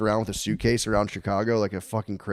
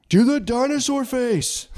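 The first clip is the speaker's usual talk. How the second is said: put-on high, loud, slow child's voice; drawn-out high-pitched kiddie demand; demanding little kid; 4 dB louder, slower than usual. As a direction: mimic a kid begging on a video call. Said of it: "They FaceTime you".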